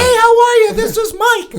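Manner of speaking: high, loud, giggly sing-song greeting; glee club intro. Pretend. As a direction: chirp it like a cheery glee club. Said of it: "our little Glee Club intro thing".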